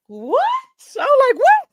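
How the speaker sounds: high, loud stunned "what?"; retold shock, voice leaping way up; shocked surprise. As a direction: squeal it in disbelief at a surprise.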